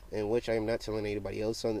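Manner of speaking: ordinary talk, nothing marked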